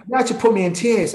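ordinary talk, nothing marked

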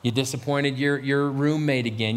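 low, measured self-reproach; low, heavy drumbeat of blame; three a.m. inner voice. Acted. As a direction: accuse yourself in a low late-night voice. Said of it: "This is my three o'clock voice".

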